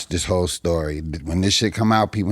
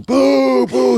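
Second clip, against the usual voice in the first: two high, loud boos; repeated booing; 7 dB louder than usual. Retold or imitated. Heckler as comic goes back on, loud high boos. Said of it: "Who is this booing me".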